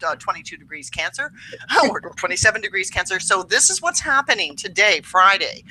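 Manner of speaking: ordinary talk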